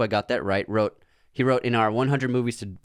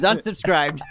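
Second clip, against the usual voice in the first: laughing verdict; 7 semitones higher, slower than usual. Acted.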